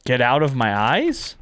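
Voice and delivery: usual speaking voice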